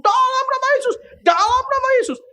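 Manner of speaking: very high strained mock shout; retold frantic proclamation. Preacher re-enacting his shout during the flight, frantic proclamation. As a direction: cry it out in exaggerated panic. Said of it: "saya kumandangkan juga".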